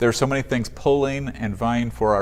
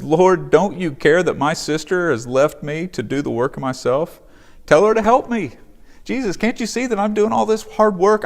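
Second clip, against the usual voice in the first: much higher, louder, exasperated whine; frazzled Martha; 9 semitones higher, 5 dB louder than usual. Retold or imitated. Martha complaining to Jesus about her sister, high exasperated whine. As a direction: play Martha at the end of her rope, pleading with Jesus. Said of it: "she says".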